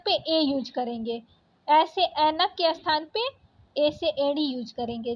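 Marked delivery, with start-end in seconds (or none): none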